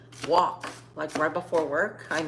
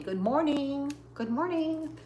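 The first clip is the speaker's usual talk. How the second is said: high singsong bird voice, rising higher; birds' greeting; 8 semitones higher, 3 dB quieter than usual. Retold or imitated. Vlogger imitating the birds in her trees, high chirpy singsong. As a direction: chirp it like a cheerful morning bird. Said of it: "They were telling me".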